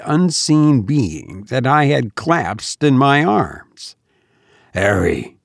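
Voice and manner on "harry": hoarse, agitated. A narrator's reading. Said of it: "in a hoarse, agitated voice".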